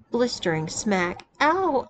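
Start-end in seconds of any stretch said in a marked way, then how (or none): 1.4-1.9 high yelp of pain; sobbing yelp. A narrator's reading. Narrator voicing the sissy's yelp at the bare smack. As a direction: yelp out, near tears. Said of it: "I sobbed".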